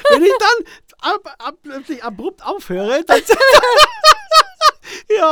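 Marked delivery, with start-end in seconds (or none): 3.3-5.0 very high, loud cackling burst; explosive laughter. Shown as a demonstration. Guest shows how he laughs and stops, explosive laughter. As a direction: let a shrieking laugh burst out abruptly.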